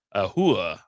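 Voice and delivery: "hua" stretched slowly as HOO-ah. Shown as a demonstration. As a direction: draw out "hua" with no final r. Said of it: "not a horror".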